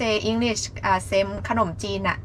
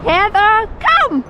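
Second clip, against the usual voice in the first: squeaky praising a dog; 5 dB louder, much slower than usual. Real, not acted. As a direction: praise the dogs brightly. Praising dogs.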